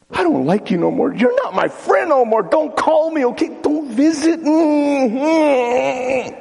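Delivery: high tearful shouting friend; tantrum crying. Pretend. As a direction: wail it out like a sulking child. Acting friend breakup tantrum.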